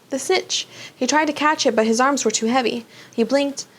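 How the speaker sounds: ordinary delivery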